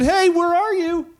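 loud, slow, high call across the garden; God calling loudly for the man. Acted. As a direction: call out for someone you can't see. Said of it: "the Lord God called to the man and said".

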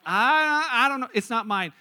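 hesitant "I don't know", then "It's not mine" dropping away; reluctant admission. Acted. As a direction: stall on a loud "I", then concede "It's not mine" quickly. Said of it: "you just can't say that yet".

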